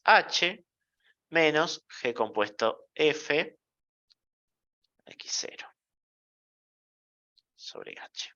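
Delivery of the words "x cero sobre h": 1 trailing whisper. Real, not acted. Susurra al final de la fórmula.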